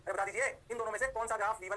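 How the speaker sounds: usual speaking voice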